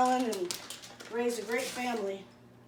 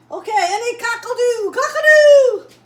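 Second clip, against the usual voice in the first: Elizabeth Taylor; 12 semitones higher, 16 dB louder than usual. Retold or imitated. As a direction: shriek it like a movie diva. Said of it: "That's what Elizabeth Taylor says".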